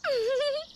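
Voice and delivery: pleased little high-pitched laugh; happy giggle. Acted. Rita giggles happily before her news.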